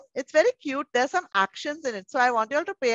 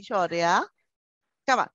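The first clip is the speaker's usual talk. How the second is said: normal talking voice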